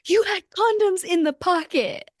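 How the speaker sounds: high-pitched imitation of yelling; hammed-up shriek of accusation; mock outraged yell. Snowboarder retells her teammate confronting her over the prank, mock yell. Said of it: "just yelling at me".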